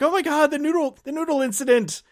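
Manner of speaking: squealing high with glee; giddy high-pitched fan squeal; delighted recognition. Retold or imitated. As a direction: fan spotting an in-joke. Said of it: "I'm like".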